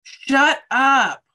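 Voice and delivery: loud disbelieving shout; shocked outburst. Real, not acted. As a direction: blurt it out in disbelief. Listener reacting to the news.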